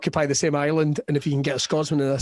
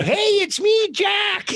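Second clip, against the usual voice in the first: put-on old man voice; much slower than usual. Eighty-year-old from Coatbridge greeting, much higher voice. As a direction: play the eager old emigrant.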